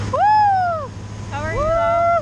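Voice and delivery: high, loud thrilled whoop; excited shout. Real, not acted. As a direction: yell it out with joy.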